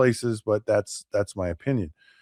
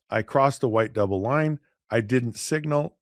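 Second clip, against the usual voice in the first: ordinary talk, nothing marked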